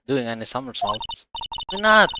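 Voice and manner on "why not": higher, louder pushy why not; eager whine. Acted. Maya pestering Phoenix for somersaults, high and loud. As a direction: needle him like a bratty little sister.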